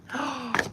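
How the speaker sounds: sharp high gasp of shock. Real, not acted. Gasp at the phone to the live viewers.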